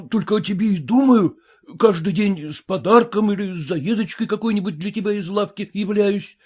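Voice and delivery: mild flustered exclaiming. A narrator's reading. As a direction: plead in helpless confusion. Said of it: "всплёскивал руками и восклицал".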